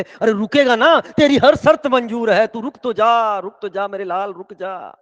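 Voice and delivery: loud, high plea easing off at the end; desperate pleading family. Acted. Family agrees to any condition if son stays, desperate pleading. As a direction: implore loudly, then taper into a tender beg. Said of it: "घर वाले भी कहे".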